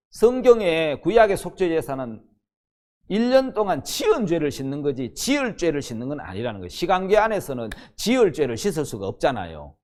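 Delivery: ordinary talk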